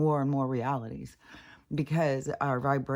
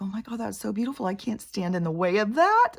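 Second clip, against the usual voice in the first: higher, mildly tearful retelling; retold tearful gushing; 6 semitones higher, 4 dB louder than usual. Recalling puppy finding his family, retold tearful gushing. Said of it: "I start crying".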